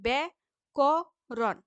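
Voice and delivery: ব্যাকরণ split into three slow beats; syllable by syllable. Shown as a demonstration. Teacher splitting ব্যাকরণ into three syllables, slow. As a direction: give ব্যাকরণ three separate stresses. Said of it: "তিনবার ঝোঁক দিতে হয়".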